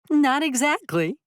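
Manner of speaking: high, loud coy tease; giggly young woman. A narrator's reading. Renter teasing Bolin, high and coy.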